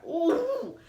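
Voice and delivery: high, drawn-out ooh; exasperated ooh. Real, not acted. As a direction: let out a long high ooh. Venting after angry rant.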